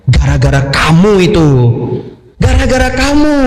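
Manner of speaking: jealous wife blames her sleepy husband; blaming wife. Retold or imitated.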